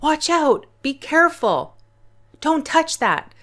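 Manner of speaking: high, urgent warning cry; concerned exclamation. Shown as a demonstration. Teacher demonstrating an exclamation to students, urgent warning. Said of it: "when someone is expressing excitement or concern".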